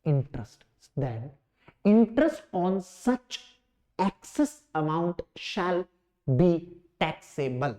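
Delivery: low, measured dictation with long gaps; softly spaced-out classroom dictation; slow dictation. Real, not acted. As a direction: dictate word by word so students can write. Dictates answer for students to write.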